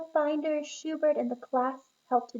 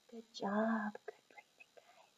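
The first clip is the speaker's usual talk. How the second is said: soft whisper; 7 dB quieter than usual. Real, not acted. Praising after breathing.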